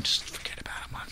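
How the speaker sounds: hushed whisper, no voice. Retold or imitated. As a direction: whisper it to calm him down. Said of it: "my dad will be over there going".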